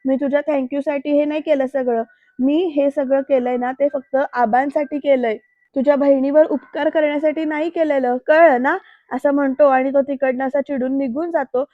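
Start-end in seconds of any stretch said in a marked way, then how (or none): none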